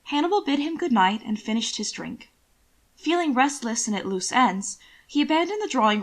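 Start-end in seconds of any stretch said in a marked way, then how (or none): none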